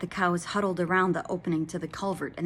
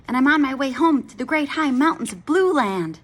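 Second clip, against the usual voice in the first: put-on baby dragon; 7 semitones higher, 7 dB louder than usual. Dragon explains.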